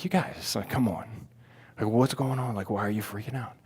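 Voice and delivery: soft, under-the-breath pleading; quietly baffled by the fuss. Retold or imitated. Speaker to frenzied pilgrims around him, quietly incredulous. Acting own unimpressed reaction to crowd.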